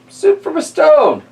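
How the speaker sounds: put-on high, slow, amazed old lady; squeaky old lady. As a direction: marvel like a tiny old woman. Old lady marvels in story.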